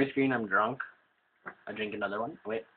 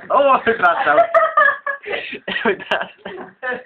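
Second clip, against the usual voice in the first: laughing; 5 dB louder, slower than usual. Real, not acted. Laughing fit while playing.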